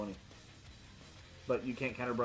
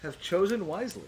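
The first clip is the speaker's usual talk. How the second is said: higher, louder mock-grand verdict; mock solemn; 5 semitones higher, 5 dB louder than usual. Game master praising the player's pick, hammed-up grandeur. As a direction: pronounce it like a wise oracle.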